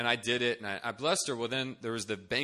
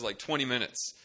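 usual speaking voice

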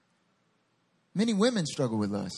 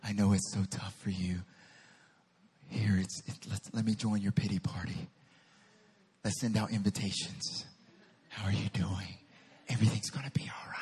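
low, soft, half-whispered sympathy; mock-soothing small voice; 8 semitones lower, 4 dB quieter than usual. Preacher parodies God comforting Elijah, hushed and soothing. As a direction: coddle with hushed, overdone comfort. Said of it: "The still small voice was not like".

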